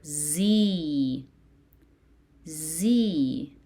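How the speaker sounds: "Z" as a drawn-out zee; "Z" arching up, then falling, stretched; long E syllable. Shown as a demonstration. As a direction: hold the ee in "Z" for repeating. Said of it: "it's gonna pronounce as".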